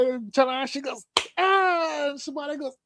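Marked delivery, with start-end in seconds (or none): 1.4-2.1 high, held imitation wrestler yell; high drawn-out "aah"; mock battle cry. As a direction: answer with a high-pitched war cry.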